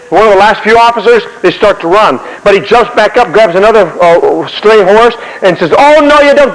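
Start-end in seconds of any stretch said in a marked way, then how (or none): 5.7-6.5 high, emphatic stop order; acted officer's raised-pitch protest; commander halting deserters. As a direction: call out to stop men fleeing. Acts Washington stopping fleeing men.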